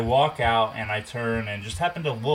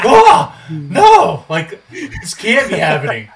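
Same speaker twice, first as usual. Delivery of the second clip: very high, loud, laughing "oh, no"; panicked outcry; 7 semitones higher, 13 dB louder, slower than usual. Retold or imitated. Cat crawls under his new car.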